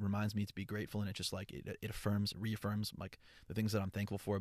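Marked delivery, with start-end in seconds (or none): none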